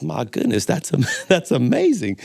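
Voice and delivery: high-pitched wonder with a chuckle; amazed joke character. Acted. Thibodeau hears the dog's war stories, amazed. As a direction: marvel like the astonished buyer.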